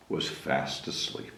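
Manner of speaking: soft breathy ending; hushed whisper. A narrator's reading. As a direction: whisper it sleepily.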